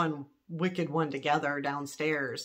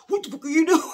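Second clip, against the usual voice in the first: higher, louder mock yell with a laugh; imitating angry ex; 11 semitones higher, 8 dB louder, much faster than usual. Quoting ex-husband's usual outburst, laughing mock yell. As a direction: fling the ex's outburst, half laughing. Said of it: "He wouldn't say".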